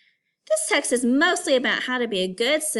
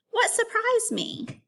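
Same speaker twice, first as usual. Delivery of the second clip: much higher, slow and drawn out; emphatic question; 12 semitones higher, slower than usual. Shown as a demonstration. Teacher modeling a big question, slow and much higher. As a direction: pose the question slowly so it sinks in. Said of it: "three big questions you can ask yourself".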